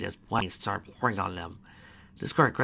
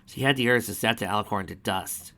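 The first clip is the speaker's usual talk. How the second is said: ordinary delivery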